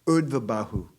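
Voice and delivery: "Urdhva Bahu" said with emphasis; emphatic. Retold or imitated. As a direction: put firm weight on "Urdhva Bahu". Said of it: "very emphatically".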